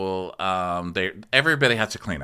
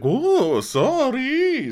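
high, loud fake-surprised whoa, sorry; a hammed-up jump of surprise as he backs out; mock startled apology; 6 dB louder, much slower than usual. Mocking a startled guy leaving.

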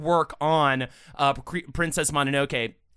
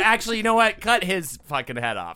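ordinary delivery

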